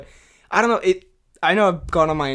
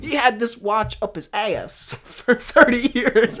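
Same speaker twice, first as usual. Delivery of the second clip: imitating Walken; 4 semitones higher, slower than usual. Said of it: "or whatever he says".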